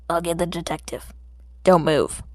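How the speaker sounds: gruff officer giving a sharp command; stern cop order. Acted. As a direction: warn the kid not to move. Cathead leaves Izuku with orders.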